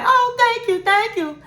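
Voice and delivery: high, sugary mock gratitude; mock gushing thanks. Wife mock-thanking husband for pageant money, high and gushing. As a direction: gush it out in a high put-on voice. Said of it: "And she's like".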